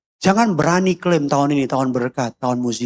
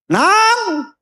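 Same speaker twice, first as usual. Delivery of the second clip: high, drawn-out call; parent calling out; 4 dB louder than usual. Retold or imitated. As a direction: summon a child from across the house. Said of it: "orang tua manggil gini". (panggilan orang tua dengan nada tinggi)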